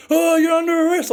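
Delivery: high, loud mocking cop voice; mimicking the cop who punched him; mock smug cop. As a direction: lampoon the arresting cop.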